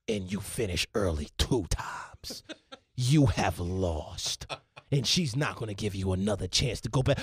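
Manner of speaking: low, hushed pundit gravity; Stephen A Smith. Acted. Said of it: "Stephen A Smith".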